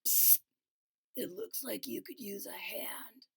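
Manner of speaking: unvoiced kind whisper. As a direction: whisper a friendly offer. Cool beans whisper offer.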